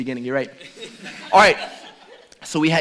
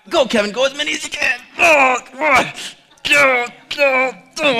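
higher, louder cheering; cheering shout; 9 semitones higher, 8 dB louder than usual. Real, not acted. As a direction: urge him on at full voice. Cheering Kevin on.

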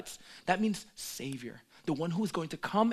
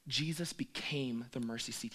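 whisper; 3 semitones lower, 6 dB quieter than usual. Real, not acted. Solemn point.

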